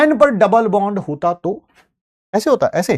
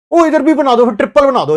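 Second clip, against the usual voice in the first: high, loud and insistent; mock student demands; 7 semitones higher, 6 dB louder than usual. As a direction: imitate a student piling on requests. Teacher voices students' repeated demands. (छात्र की नकल में ऊँची, ज़ोरदार फ़रमाइश)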